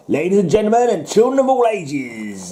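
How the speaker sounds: high-pitched ringmaster's call; circus announcer. Acted. Ringmaster to the circus audience, grand high announcement. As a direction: announce the big show to the crowd.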